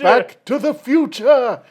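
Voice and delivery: high triumphant exclamation; excited cry. Pretend. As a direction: shout the title like a trailer. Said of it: "And he's like".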